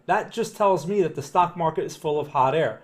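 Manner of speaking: usual speaking voice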